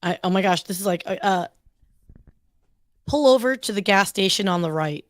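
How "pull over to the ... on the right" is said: everyday American accent with firm R's. Shown as a demonstration. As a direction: model the line in plain American English. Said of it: "you've gotta give me a phrase".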